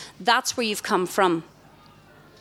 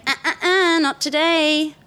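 higher, sassy uh-uh-uh; firm refusal; 6 semitones higher than usual. Pretend. Telling the negative thought off, sassy and higher. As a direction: wag a finger at the thought. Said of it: "You're gonna say".

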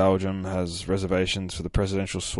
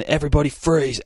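high, loud shouted command; raised voice, sharp mock yell; retold scream; 8 semitones higher, 5 dB louder than usual. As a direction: imitate a screamed order. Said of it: "there is a screaming".

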